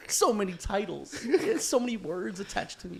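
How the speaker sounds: high, slow, laughing disbelief; amused groan, laughing. Real, not acted. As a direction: laugh through the complaint about titles. Laughing at his many titles.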